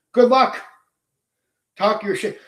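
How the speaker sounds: sarcastic jab, high and loud; mock them with a loud high send-off; scornful well-wish; taunting good luck. Real, not acted. Taunting would-be challengers.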